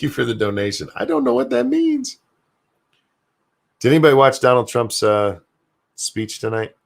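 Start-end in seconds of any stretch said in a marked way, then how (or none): 0.9-2.2 high, bewildered squeak; utterly lost at a joke; very high confused whine; baffled. Real, not acted. Confused by donation message.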